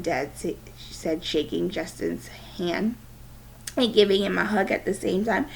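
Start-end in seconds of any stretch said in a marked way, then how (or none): none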